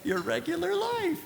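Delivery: high amused repeat, half laughing; bitter laughing echo. Real, not acted. Patient echoing the surgeon with a laugh. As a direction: scoff at the idea, laughing.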